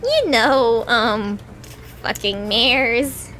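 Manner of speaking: very low, dragged-out embarrassed mumble; hesitant Lyra. A narrator's reading. Lyra awkwardly explaining to Pinkie, very low and slow. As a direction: force the last words out, very slowly. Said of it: "Lyra said hesitantly".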